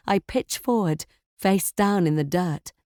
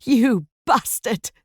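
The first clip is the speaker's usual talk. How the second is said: furious slow yell; 4 semitones higher, 4 dB louder, slower than usual. A narrator's reading. Wife screams at murderer husband.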